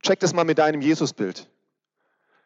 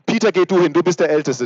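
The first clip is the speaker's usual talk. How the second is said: put-on higher, louder child's pushy voice; squabbling kid; 4 semitones higher, 6 dB louder than usual. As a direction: shove your brother forward. Kinder streiten, wer hingeht. (Kind im Streit, laut und höher)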